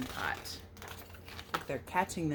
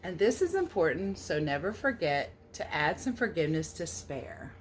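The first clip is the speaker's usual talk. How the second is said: storybook read-aloud; 4 dB louder than usual. A narrator's reading. Reading the American Pie book aloud to children, storybook lilt. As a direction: read the verse a little louder, with a gentle lilt. Reads the picture book text.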